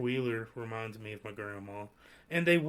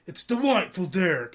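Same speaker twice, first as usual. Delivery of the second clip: proud Derek correction; 6 semitones higher, 9 dB louder than usual. Retold or imitated. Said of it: "He's like".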